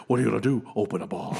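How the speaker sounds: low, dropping impression of a movie tough-talker; Dan Hedaya. Podcaster quoting a comedian's impression of an actor, low put-on voice. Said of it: "that was Billy Crystal doing Dan Hedaya".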